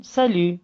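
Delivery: Salut said saly, u as Turkish ü, t silent; French salut. Shown as a demonstration. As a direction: Salut with rounded ü, no final t. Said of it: "Ü gibi telaffuz edilir".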